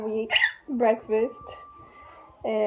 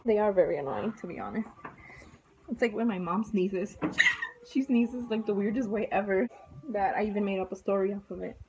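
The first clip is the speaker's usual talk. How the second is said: ordinary talk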